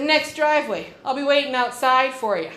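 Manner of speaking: shouting her address at the hillbillies; retold shout. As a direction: holler the address across the field. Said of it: "I shouted it across the field".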